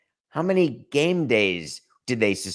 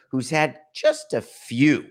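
voice crack; 3 semitones higher, slower than usual. Real, not acted. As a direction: voice cracking on a word. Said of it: "quite a voice crack for Monday morning".

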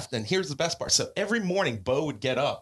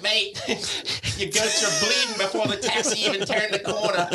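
a hint of high Australian glee over a laugh; reading out the roommate's email in a light Australian voice; lightly mock Australian mate; 8 semitones higher than usual. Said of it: "He's Australian".

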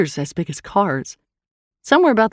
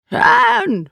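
high, loud creature shout; 5 dB louder than usual. A narrator's reading. The Eye shouts at Hand from above, high shout. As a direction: shout the name high and urgently. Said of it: "it shouted again".

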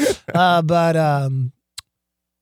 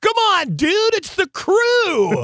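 higher, louder, slow plea to calm him down; a loud, higher, drawn-out plea to the angry biker; pleading shout; 5 dB louder, slower than usual. Retold or imitated. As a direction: shout over the noise to the biker. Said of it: "I go".

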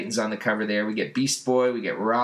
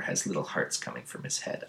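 normal talking voice

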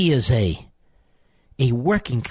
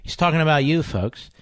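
usual speaking voice

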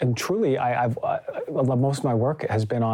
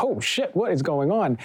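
sudden high alarmed yelp; retold shock as the room erupts; startled; 6 semitones higher than usual. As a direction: jump up in shock, then slightly high. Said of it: "I was like".

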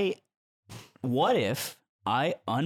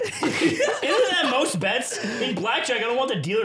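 laughing; 10 semitones higher, 5 dB louder, slower than usual. Real, not acted. Laughing argument about bets.

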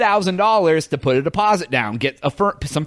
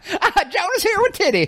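laughing; 13 semitones higher than usual. Acted. Mock host intro, laughing.

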